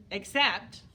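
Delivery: ordinary delivery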